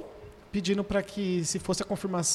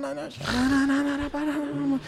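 high, drawn-out babble imitating tongues; imitated speaking in tongues; 7 semitones higher, much slower than usual. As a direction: mimic the tearful tongues, higher and slow. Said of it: "chorando e só falando em línguas". (imitando falar em línguas, agudo e arrastado)

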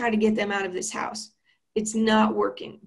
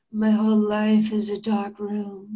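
put-on deadpan goth teen. Goth teen daughter's reply.

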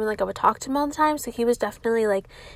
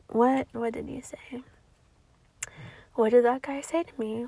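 whisper to self; 6 dB quieter than usual. Real, not acted. Trying to remember, whispered.

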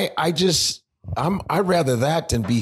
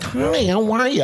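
high, drawn-out sweet greeting; mock gushing hello, pitched way up; put-on high girly politeness; imagined friendly girl; 9 semitones higher, slower than usual. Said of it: "maybe you'd be like".

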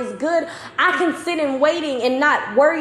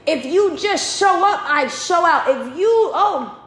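higher-pitched, building preaching; getting excited; 4 semitones higher than usual. Real, not acted. Preacher promising God will show out, getting excited. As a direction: press the promise with rising excitement. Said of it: "I'm getting excited".

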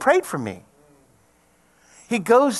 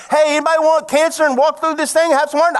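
higher, louder, mock cheery ask; mock call for volunteers; 9 semitones higher, 6 dB louder, faster than usual. Preacher joking God asked for volunteers, mock cheery. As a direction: play it like a goofy sign-up call. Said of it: "God didn't go".

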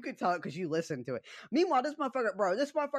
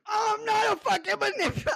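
much higher, louder mock outburst; imitated yell; 14 semitones higher, 3 dB louder than usual. Opponent denies being a manipulator, hammed-up yell. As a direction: yell it like someone losing it. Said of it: "he just started yelling".

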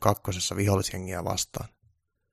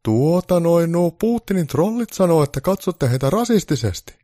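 raised, loud Santa trying to explain; Santa's higher, louder explaining voice; 10 semitones higher, 8 dB louder than usual. Acted. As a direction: make Santa fumble an explanation. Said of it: "joulupukki yritti selittää".

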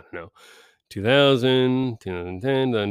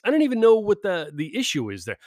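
ordinary talk

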